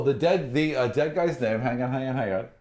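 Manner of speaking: ordinary talk, nothing marked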